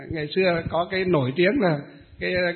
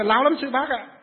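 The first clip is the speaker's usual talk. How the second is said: indignant complaint; 7 semitones higher than usual. Retold or imitated. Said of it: "nói là".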